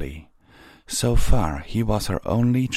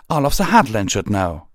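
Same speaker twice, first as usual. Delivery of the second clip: higher, louder, heated retort; indignant insistence; 4 semitones higher, 6 dB louder than usual. Acted. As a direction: argue back with force. Pyre Valor argues back angrily.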